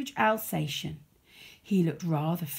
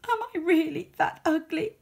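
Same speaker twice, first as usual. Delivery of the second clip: tearful; 11 semitones higher, 4 dB louder than usual. Acted. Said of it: "burst into tears".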